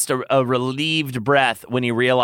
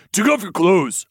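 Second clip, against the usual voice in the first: higher, firm command in character; ordered; 7 semitones higher, 3 dB louder than usual. A narrator's reading. Lucian takes charge of the lover, ordering. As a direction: give it as an order. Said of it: "Lucian ordered".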